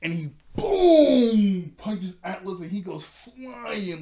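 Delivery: slow, loud, high excited cry at the punch. Real, not acted. Recapping the fight for viewers, excited loud cry. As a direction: cry out the punch in delight, drawn out.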